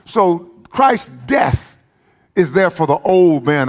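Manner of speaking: high, ringing shout of a preacher, slowing on Christ's death; preaching at full force. Acted. Preacher shouting to the congregation, fervent full-force preaching. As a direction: lift the church with it, drawn out at the end.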